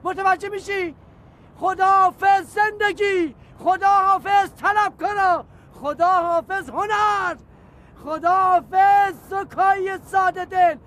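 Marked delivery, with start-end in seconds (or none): 1.6-10.7 dramatic farewell. Acted. Farewells before jumping, slow loud.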